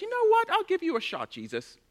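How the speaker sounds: high, offhand mock generosity; mock condescending self. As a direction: act like you're doing Jesus a favour. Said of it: "I said".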